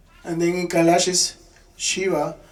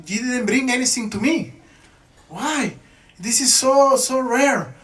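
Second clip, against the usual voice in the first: pleading wife; 6 semitones higher than usual. Retold or imitated. Lakshmi Devi begging Narada.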